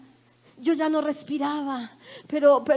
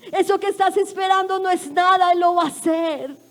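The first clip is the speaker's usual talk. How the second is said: raised, higher-pitched proclamation; fervent preacher's declaration, pitched up; emphatic preaching; 4 semitones higher, 4 dB louder than usual. Real, not acted. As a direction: proclaim the promise with force. Emphatic promise preached to the congregation.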